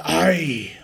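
"Ai" in a playful put-on accent. Shown as a demonstration. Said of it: "put an accent on it".

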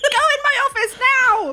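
high, loud shouted command; frantic order. Acted. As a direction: shout them out of sight, high and urgent. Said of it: "She's like".

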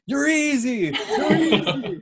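high-pitched crowing with laughter; mock taunt. Player taunting after pancaking the ball, high mock crow. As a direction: crow it way up high, imitating him.

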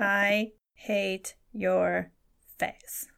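very slow, word by word; pretend coded message. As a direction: spell it out with long gaps between words. Jokingly blinking out a slow message.

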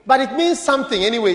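ordinary talk

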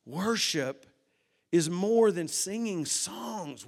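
usual speaking voice

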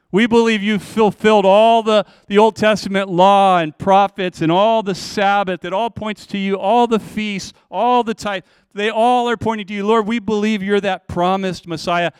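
fervent confession. Acted. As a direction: proclaim it with growing fervor. Preacher voices Peter's confession.

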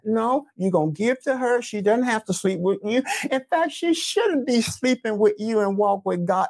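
ordinary talk, nothing marked

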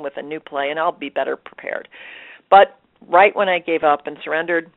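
normal talking voice

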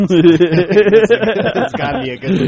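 laughing loudly, slowly. Real, not acted. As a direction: laugh through the words. Joking about the cover.

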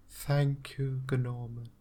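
lightly voiced ghostly whisper of a woman; a faint voice on the wind; Giselda's voice. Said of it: "in Giselda, hairy cabbage's voice".

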